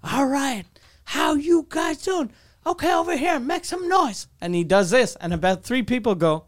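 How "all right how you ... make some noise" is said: imitated MC hyping the room; hype MC. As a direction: work a thin crowd like an MC. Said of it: "the M C was the best, 'cause he was like".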